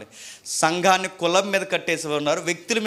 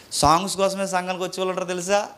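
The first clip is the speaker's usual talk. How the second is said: ordinary talk, nothing marked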